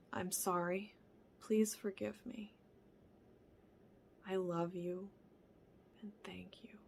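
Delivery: soft whispered prayer of four phrases; Ho'oponopono prayer. Shown as a demonstration. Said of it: "It's those four components".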